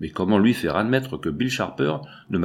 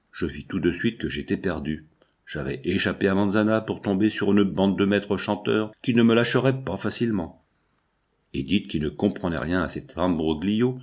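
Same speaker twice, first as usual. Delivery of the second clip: plain reading voice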